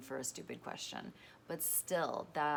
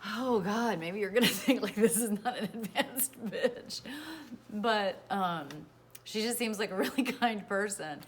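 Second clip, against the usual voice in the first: teary laughter, louder and higher; laughing through tears; 5 semitones higher, 8 dB louder than usual. Real, not acted. Confessing to followers, laughing through tears.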